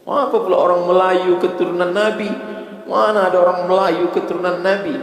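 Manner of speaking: high, loud mocking rhetorical challenge; preaching at full force.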